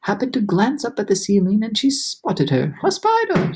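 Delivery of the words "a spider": high alarmed cry of disgust; cried out. A narrator's reading. Aunt spotting a spider, high alarmed cry. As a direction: shriek it at the sight of a spider. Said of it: "she cried".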